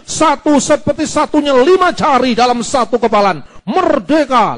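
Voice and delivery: loud, rising oratory building to a rallying cry; full preaching. Shown as a demonstration. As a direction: demonstrate a rousing sermon at full force. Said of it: "Begitu mereka mulai sadar saya khotbahi".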